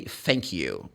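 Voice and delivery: "Thank you" with th said as f, "Fank you"; Cockney TH. Shown as a demonstration. Teacher quoting how some Londoners talk, th as f in "Thank you". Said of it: "people say".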